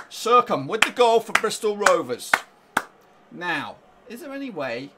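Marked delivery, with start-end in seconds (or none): 0.1-2.3 louder, higher goal call; excited announcement. Real, not acted. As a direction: proclaim the scorer with relish. Repeating goal news excitedly.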